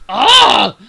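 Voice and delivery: very loud, high groan of frustration; exasperated yell. A narrator's reading. Captain fed up with questions, exasperated yell. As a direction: let out an impatient roar. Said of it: "The captain lost his patience".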